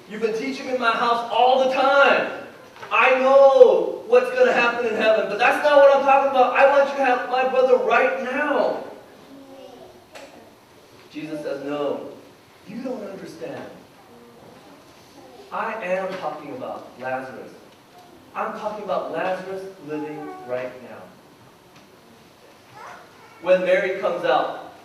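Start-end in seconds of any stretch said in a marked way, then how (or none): 0.1-8.8 louder, higher exasperated protest; impatient, emphatic pleading; frustrated insistence. Retold or imitated. Martha argues impatiently with Jesus. 11.6-21.0 low, soft, patient insistence; gentle, lowered-voice assurance; calm correction. Retold or imitated. Jesus calmly corrects Martha.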